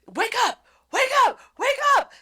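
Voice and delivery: high, loud repeated calls; urgent calls. Retold or imitated. Rain shaking her awake, yelling. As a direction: shout to wake her.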